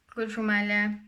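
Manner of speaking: usual speaking voice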